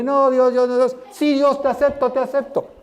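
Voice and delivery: high-pitched mock pleading with God; hammed-up high, hurried surrender; acted reluctant acceptance. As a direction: act out backing away while accepting, pitched high. Acts out backing away then accepting.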